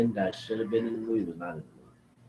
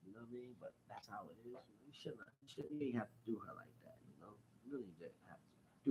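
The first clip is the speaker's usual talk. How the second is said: very soft, deflated aside; resigned muttering; 23 dB quieter, faster than usual. Real, not acted. Viewer regretting a character's death, muttering. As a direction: drop right down, almost to yourself.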